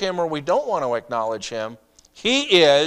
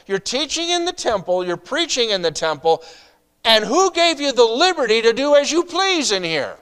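mock challenge; 8 semitones higher, 4 dB louder than usual. Voicing the leaders' challenge to Jesus.

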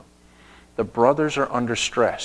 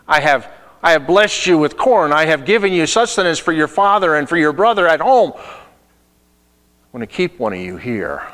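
higher, much louder stern accusation; stern accusing ruler; 5 semitones higher, 7 dB louder than usual. Acted. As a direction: call them back and accuse them with mock fury. Joseph voiced accusing the brothers.

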